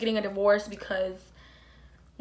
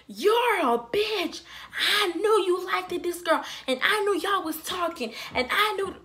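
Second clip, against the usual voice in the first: retold accusation; 7 semitones higher than usual. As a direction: throw the jealous wife's accusation back at her. Neighbor accusing her of flirting.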